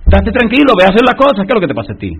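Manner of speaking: loud, high command from God, calm words delivered at full power; booming God voice. Acted. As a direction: thunder the reassurance down at Moses. God reassuring Moses, preacher voicing.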